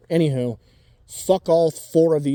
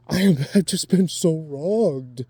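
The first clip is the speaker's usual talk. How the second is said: slow, sarcastic whine of self-pity; sarcastic put-upon victim voice; mock whining victim; slower than usual. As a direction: drawl it out like a martyr. Mocking the self-pitying victim.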